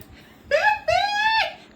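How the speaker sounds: very high, loud squealing laugh; half laugh, half shout; shrieking laugh. Real, not acted. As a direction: let out a screaming burst of laughter. Shrieking laugh at her own news.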